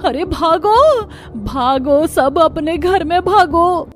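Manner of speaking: frightened villager shrieking a warning; terrified screaming. Acted. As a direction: scream at everyone to run home. Villager sees ghost horde.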